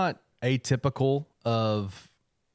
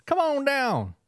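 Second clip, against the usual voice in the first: put-on Arkansas accent; 3 dB louder, slower than usual. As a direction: play a folksy Southern gate guard. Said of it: "They'd have been like".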